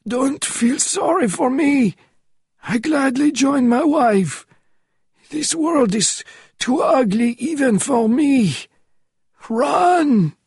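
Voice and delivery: desperate shout. A narrator's reading. Last words before explosion.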